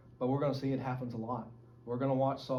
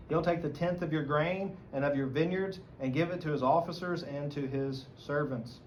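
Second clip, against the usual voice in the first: usual speaking voice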